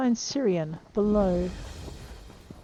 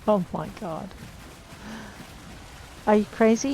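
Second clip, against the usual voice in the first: ordinary talk, nothing marked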